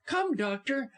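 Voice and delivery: high, urgent wife; distraught, high-voiced urging; impatient. A narrator's reading. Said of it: "she answered impatiently".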